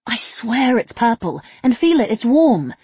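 loud, high, insistent protest; exasperated, emphatic plea to be believed; exasperated groan. A narrator's reading. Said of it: "N groaned".

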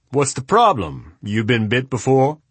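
lightly voiced curious host; booming man voice. A narrator's reading. Adams voiced.

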